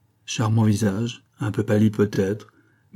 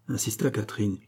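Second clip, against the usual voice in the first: ordinary delivery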